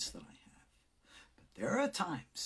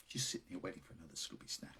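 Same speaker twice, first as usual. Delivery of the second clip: quick soft aside; 8 semitones lower, 15 dB quieter, much faster than usual. Real, not acted. Aside to the dog.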